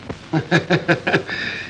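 low, soft chuckling laugh; quiet, amused laughter; amused chuckle. Acted. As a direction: chuckle indulgently at the request. Amused by the marriage request.

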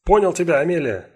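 high, loud reply called out; calling back. Acted. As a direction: call it back while running. Bell answers across distance.

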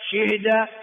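شهد with kasra on shin, sukun on ha; moved vowel. Shown as a demonstration. Teacher drilling four forms, third "shihda". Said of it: "أربع لغات".

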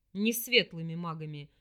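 low, contemptuous demon voice. Acted. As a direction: sneer it down at her. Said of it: "презрительно произнёс собеседник".

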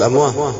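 أمواه with final h replacing t; invalid h ending. Shown as a demonstration. Teacher ruling out the reading, أمواه ending in h. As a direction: say أمواه as "amwah", no t. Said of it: "لا يصح".

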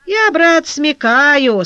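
hint that you are onto something; mysterious. A narrator's reading. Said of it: "сказал Коляр с таинственным видом". (таинственно, с намёком)

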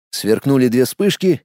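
ordinary delivery

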